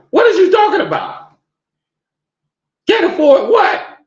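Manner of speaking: outraged disbelief. Real, not acted. Outraged rebuttal.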